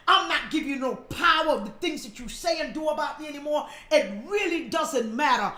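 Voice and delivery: ordinary talk, nothing marked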